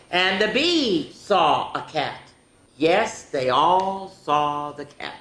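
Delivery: drawn-out storytime voice, animal names lifted high; picture-book read-aloud. A narrator's reading. Reading They All Saw a Cat to children, dramatic storytime voice. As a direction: swoop up on each creature, then step down slowly.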